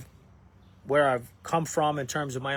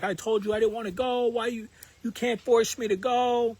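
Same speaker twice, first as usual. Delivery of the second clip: high-pitched protest of a tearful kid, imitated; mock-tearful whine, pitched well up; upset, crying child; 7 semitones higher than usual. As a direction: imitate a child wailing a complaint, without real tears. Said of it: "he started crying".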